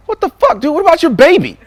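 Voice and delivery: high, loud incredulous yelp; mock-horrified cry about the baby; shocked disbelief. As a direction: squeak out outrage at the forgotten baby. Shocked neighbor forgot his baby.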